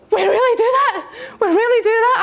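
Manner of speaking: high, laughing disbelief; gasp, then laugh it out in wonder; astonished gasp. Retold or imitated. Woman retelling her shock at a trainer's question, high amazed laugh.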